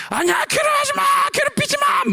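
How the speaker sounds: very high creature shriek; desperate yelling. Acted. Tormented creature fighting its evil side, desperate shriek. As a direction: scream it out to drive the tormentor away. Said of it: "막 소리쳤더니".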